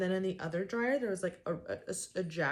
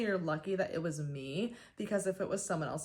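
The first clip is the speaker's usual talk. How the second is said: usual speaking voice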